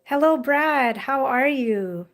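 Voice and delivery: ordinary talk, nothing marked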